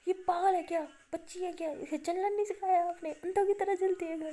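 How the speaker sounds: loud fretful tirade; scolding outburst. Acted. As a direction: tear into her carelessness. Maya scolds over friend's injury.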